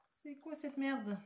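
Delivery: fed up with the stuck lid; exasperated. Real, not acted. Case will not open.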